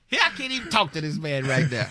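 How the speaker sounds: ordinary delivery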